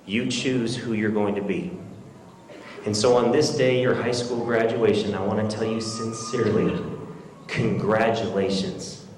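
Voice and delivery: low, soft, measured speech; sincere address. Real, not acted. Sincere closing address to graduates.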